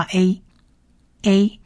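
short "A" said slowly; vowel demo. Shown as a demonstration. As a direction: model the "A" vowel for listeners. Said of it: "它就是念A，A的音". (示范短母音A)

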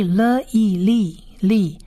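"了" and "意" said apart, then joined as "利"; syllable built piece by piece up to "利"; blending sounds. Shown as a demonstration. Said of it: "起来发长意的音".

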